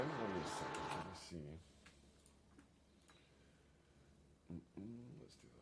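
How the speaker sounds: very soft, trailing murmur; talking to himself. Real, not acted. Commentator sorting out his track map, murmuring to himself. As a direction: think aloud under your breath.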